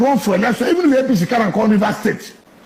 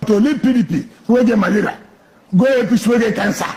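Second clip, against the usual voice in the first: usual speaking voice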